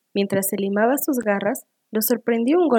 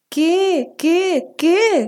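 high, loud repeated demand; storybook fox at wit's end; exasperated shout; 8 semitones higher, 6 dB louder, much slower than usual. A narrator's reading. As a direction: shout each question impatiently. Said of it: "gritó el zorro".

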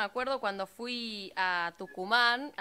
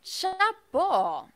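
impressed exclamation; 8 semitones higher than usual. Retold or imitated. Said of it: "La verdad que yo dije".

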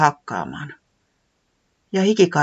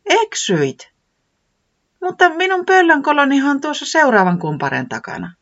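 owl voice; 12 semitones higher, 5 dB louder than usual. Acted. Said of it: "sanoi Pöllis".

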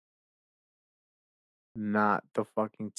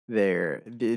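usual speaking voice

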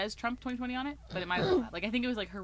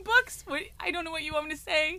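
higher and louder in disbelief; exasperated; 8 semitones higher, 8 dB louder than usual. Retold or imitated. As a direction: throw up your hands at the customer. Exasperated retelling of customer.

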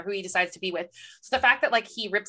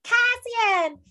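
mock calling out; 12 semitones higher, much slower than usual. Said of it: "she's like".

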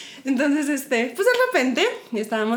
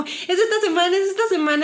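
giddy excitement; 6 semitones higher than usual. Retold or imitated. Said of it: "Como esta emoción".

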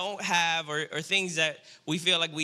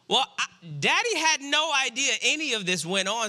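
normal talking voice

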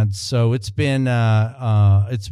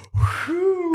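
high, airy sigh of relief with a laugh; a group exhaling after a tense moment, laughing relief; relieved whew; 3 dB quieter than usual. Retold or imitated. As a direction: let the whole room's tension out in one laughing whew. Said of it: "everybody in the room I could feel went".

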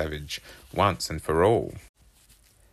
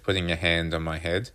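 ordinary delivery

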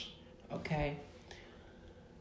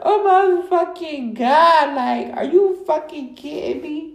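high, loud, drawn-out wail; distraught disbelief; 12 semitones higher, 10 dB louder, slower than usual. Retold or imitated. Podcaster reliving the news that Trump won, distraught wail. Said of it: "I'm just, I cried".

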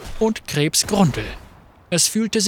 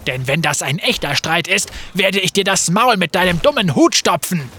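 heated threat; 2 semitones higher than usual. Acted. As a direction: square up to him. Graves threatening Fate.

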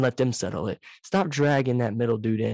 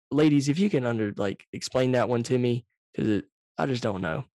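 usual speaking voice

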